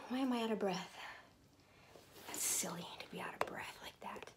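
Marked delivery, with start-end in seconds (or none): 2.3-4.3 whisper. Real, not acted. Out of breath after trying pants.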